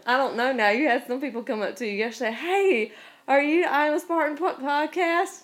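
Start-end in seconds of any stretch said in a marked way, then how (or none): 2.3-5.4 high, keen fan greeting; lightly voiced excited stranger; eager fan voice. Imitating a fan's greeting.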